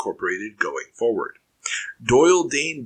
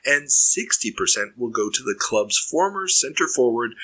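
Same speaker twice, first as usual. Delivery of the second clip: ordinary talk, nothing marked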